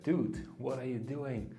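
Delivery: ordinary talk, nothing marked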